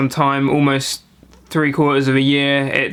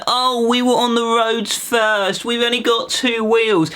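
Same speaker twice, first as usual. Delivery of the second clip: higher, slower whiny mockery; mocking cyclist; 7 semitones higher, slower than usual. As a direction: parody a preachy cyclist. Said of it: "they kinda get away with it".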